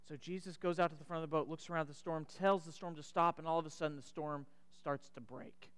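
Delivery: soft, low, hushed sermon telling; hushed storytelling. Acted. Preacher hushes the congregation for the miracle, softly. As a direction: draw the congregation in with a near-hush.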